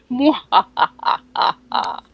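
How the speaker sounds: slow drawn-out mwahaha; evil villain laugh. Acted. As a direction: cackle like a cartoon villain. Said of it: "I always need a mwahaha".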